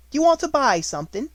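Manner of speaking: high, loud, kindly baker; a cheerful motherly baker; hearty baker woman. A narrator's reading.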